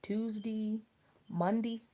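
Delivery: "Tuesday" and "Monday" ending in a short dee; local pronunciation. Shown as a demonstration. Friend listing local day names ending in dee. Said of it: "Some people say Wednesday".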